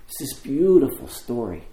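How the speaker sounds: ordinary delivery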